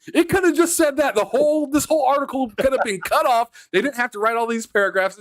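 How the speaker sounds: high, loud exasperated complaint. Real, not acted. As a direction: rant it in disbelief. Exasperated high-pitched rant.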